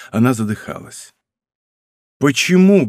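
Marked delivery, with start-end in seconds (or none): 2.2-2.8 higher, urgent entreaty; implore, voice lifted; pleading. A narrator's reading. Kneeling count to weeping princess, pleading.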